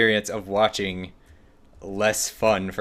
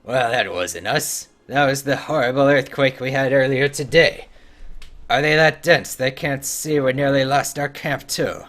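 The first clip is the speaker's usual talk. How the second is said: loud, higher hearty dwarf voice; dwarf quest-giver; 5 semitones higher, 7 dB louder than usual. Acted. Streamer voicing a dwarf quest-giver, hearty and loud. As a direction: read it like a gruff dwarf NPC.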